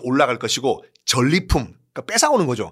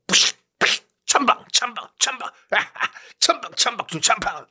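sword splash sound effects; 7 semitones higher than usual. Acted. Slashing the water.

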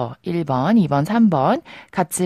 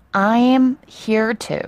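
slow model sentence; 4 semitones higher, much slower than usual. Shown as a demonstration. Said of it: "오늘 우리가 배울 표현은요".

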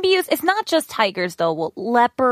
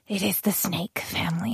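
whispering; 4 semitones lower, 10 dB quieter than usual. Real, not acted. Fear of snakes.

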